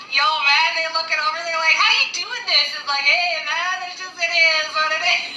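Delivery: high-pitched, carefree mock chatter; playful casual banter. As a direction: voice a laid-back soul in a high voice. Playfully voicing the querent's soul.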